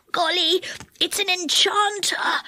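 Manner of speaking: hushed, high-pitched fright; frightened whisper. A narrator's reading. Moonface spots the enchanter, whispering in fright. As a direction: whisper it in alarm. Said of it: "whispered Moonface in a fright".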